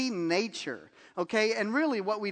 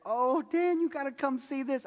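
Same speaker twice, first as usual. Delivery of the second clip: excited friend; 4 semitones higher than usual. Retold or imitated. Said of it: "man, she was so excited".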